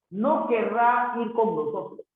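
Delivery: ordinary delivery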